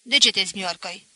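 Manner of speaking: loud, scolding bark; snapped harshly. A narrator's reading. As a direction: snap at him like a stern old woman. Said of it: "Zise răstit".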